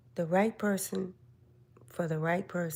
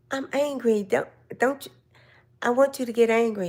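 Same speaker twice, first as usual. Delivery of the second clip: higher, louder mock-angry fuss at husband; 5 semitones higher, 5 dB louder than usual. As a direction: playfully fake being furious. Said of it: "And I'm like".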